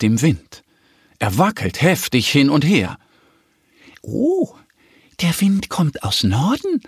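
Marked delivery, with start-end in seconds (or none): 4.0-6.8 high duck voice of discovery; surprised little duck. A narrator's reading. Little duck watches the weathercock turn, surprised. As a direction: exclaim high, then report it.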